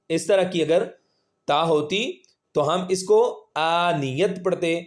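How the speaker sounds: normal talking voice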